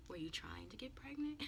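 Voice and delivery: hushed, near-whispered question; calm mother. Retold or imitated. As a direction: ask it very softly, without any scolding. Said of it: "she said it just like that".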